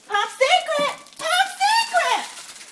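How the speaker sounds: high, loud drawn-out cry; yelling at Richard for Pop Secret; shrieking call. Acted. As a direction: wail the brand name. Yelling the popcorn brand.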